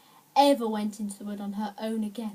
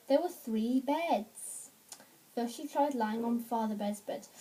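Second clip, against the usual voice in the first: usual speaking voice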